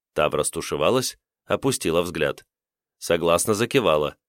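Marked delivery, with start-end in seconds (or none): none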